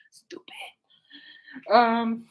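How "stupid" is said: whispered, barely voiced, through a laugh; playful whispered jab. Teasing the sister who'll watch later, playful whisper. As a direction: whisper it as a sly aside.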